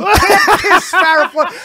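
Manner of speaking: high, loud retold plea cracked by laughter; frantic laughing protest. Comic repeating his plea to Greg, laughing. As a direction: shout the ban while cracking up.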